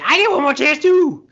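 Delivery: high, loud, manic cry; Eddie the Crackhead. Retold or imitated. Joking about the person in the audience, manic shout. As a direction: shout it like an overexcited addict. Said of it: "I think that's Eddie the Crackhead".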